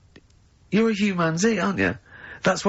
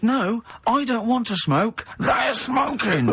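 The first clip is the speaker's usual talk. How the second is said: higher, slow exasperated protest; 9 semitones higher, slower than usual. Retold or imitated. Retelling arguing with a waitress, exasperated and high. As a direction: spell it out slowly in disbelief.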